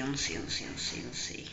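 trailing off into a scared whisper; nervous hush; fading to a whisper. Acted. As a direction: let it die down to breath. Hiding from the witch.

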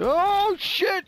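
loud high burst of shock; shocked shout. Real, not acted. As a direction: yell in sudden disbelief. Bomber explodes nearby.

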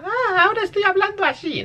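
put-on very high little voice; tonecito agudo. Shown as a demonstration. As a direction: switch into a piping girly tone. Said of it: "cuando cambian el tonecito a". (vocecita aguda y fingida)

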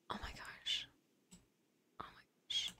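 very soft, voiceless whisper; stunned whisper. Real, not acted. Overwhelmed shopper to herself, stunned whisper.

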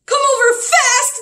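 high, loud urgent plea; pleading. Acted. Father pleads with the doctor on the phone, urgent. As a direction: beg the doctor to hurry. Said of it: "the poor father pled".

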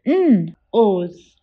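N-O's split into onset and long vowel; segmented. Shown as a demonstration. Teacher sounding out N-O's for the class. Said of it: "Can you say it out loud?".

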